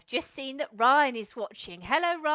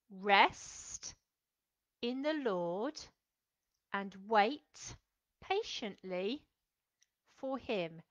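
each phrase slow with long pauses, fading softer; slow, spaced recitation; 4 semitones lower, 4 dB quieter, much slower than usual. Shown as a demonstration. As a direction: say it slowly for the children to follow. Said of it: "Do you think you can do that again".

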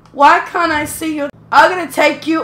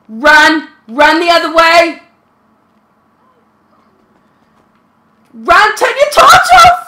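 loud urgent shouting to flee; being chased; 6 dB louder than usual. Real, not acted. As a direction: yell at a friend to run, laughing. Friend chased, urging to run.

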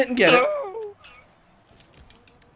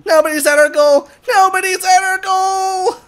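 loud, raised alarm to teammates; urgent call-out; 8 semitones higher, 9 dB louder than usual. Real, not acted. Player warning teammates of open goal, urgent shout. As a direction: shout it across to the team, rising.

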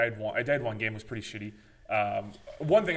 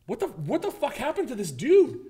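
much higher, baffled outburst; retold shock; 10 semitones higher, 4 dB louder than usual. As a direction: act stunned at what you find. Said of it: "I'm like".

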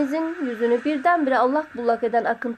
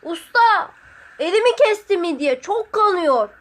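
high, raised-voice cry for the master; calling out; 5 semitones higher, 4 dB louder than usual. A narrator's reading. Sotiri calls to his master about a bleeding hand, calling out. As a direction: call out hurt, pitched up and loud. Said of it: "Sotiri seslendi".

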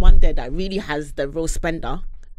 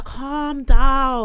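drawn-out mock; 6 semitones higher, 4 dB louder than usual. Mocking the man.

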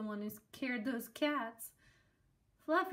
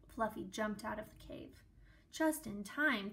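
ordinary delivery, nothing marked

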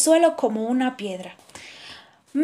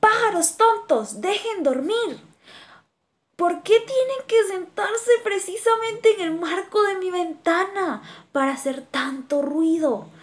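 angry shout; 7 semitones higher than usual. A narrator's reading. Kangaroo yells at the noisy birds, furious. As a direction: yell out the window. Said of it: "abre la ventana y grita".

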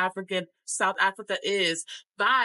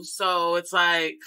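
normal talking voice

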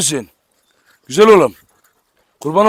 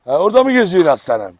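normal talking voice

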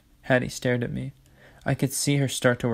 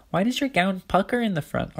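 high, curious little-sister voice; inquisitive Olive; 8 semitones higher than usual. A narrator's reading. Olive asks about the puckered gown, high and curious. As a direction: ask it high and nosy.

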